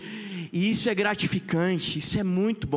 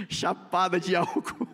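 laughing; 2 semitones lower than usual. Real, not acted. Laughing comment.

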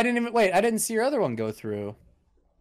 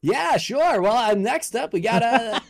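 host voice; 5 semitones higher, slower than usual. Acted. Mock host answer.

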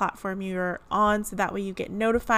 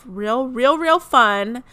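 excited; 4 semitones higher, 8 dB louder, slower than usual. Real, not acted. Building emphasis.

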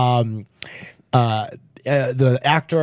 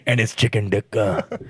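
Indian dad voice; 7 semitones lower than usual. Pretend. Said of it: "the angry Indian guy".

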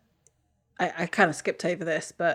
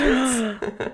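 startled sharp intake of breath; sharp gasp; 6 semitones higher, 3 dB louder than usual. Real, not acted. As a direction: gasp in surprise. Reacts to reveal.

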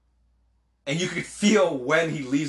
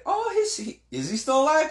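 mock surprise; 3 dB louder than usual. Mock surprise at hero's survival.

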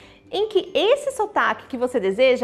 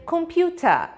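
British accent; 3 semitones higher, slower than usual. Shown as a demonstration. Teacher demonstrating British "computer", final schwa. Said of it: "Esse a se chama schwa".

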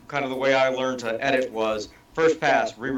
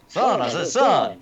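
a touch of a blustery rooster drawl; mock Foghorn bluster, son I said son; Foghorn Leghorn; 3 semitones higher, 3 dB louder than usual. Friend on a podcast quoting Foghorn Leghorn, mild mock drawl. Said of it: "it's one of those".

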